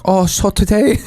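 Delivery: high put-on Chinese accent; Chinese-accented old grandma.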